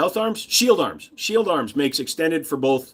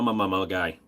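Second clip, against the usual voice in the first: ordinary talk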